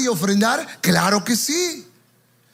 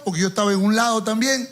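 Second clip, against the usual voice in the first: normal talking voice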